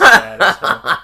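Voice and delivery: loud, slow and laughing; laughing complaint. Real, not acted. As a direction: laugh it out in mock despair. Laughing complaint about missing character.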